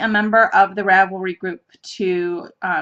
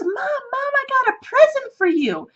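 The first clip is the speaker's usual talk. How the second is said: put-on very high child's voice; little daughter voice. Four-year-old daughter offers Mom a present, high-pitched child voice. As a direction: imitate an eager small child calling Mom.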